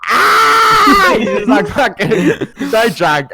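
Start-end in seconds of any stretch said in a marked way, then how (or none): 0.1-1.2 long, high, loud mock scream; imitated pained scream. Drunk friend held in a chicken wing, screaming. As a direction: scream like someone pinned.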